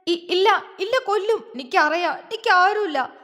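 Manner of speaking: high, halting tearful denial; girl cutting him off, crying. A narrator's reading. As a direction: stammer it through sobs. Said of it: "അവൾ കരഞ്ഞോണ്ട് പറഞ്ഞു".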